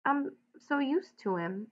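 soft. A narrator's reading. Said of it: "he said softly".

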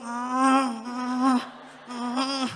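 loud, high wailed mock sobs; exaggerated crying in separate bursts; mock bawling. Preacher retells breaking down before his wife, mock bawling.

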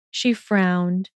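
plain reading voice

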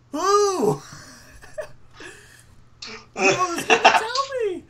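who on earth would tell me; incredulous. Real, not acted. Reacting to his friend's claim, high laughing disbelief. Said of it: "incredulous retort with laugh".

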